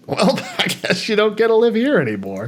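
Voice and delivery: mock callous leader. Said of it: "you go".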